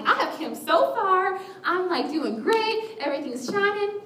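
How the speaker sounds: high, showy chirp; mock self-congratulation. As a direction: gush about your own success. Boasting perfectionist inner voice.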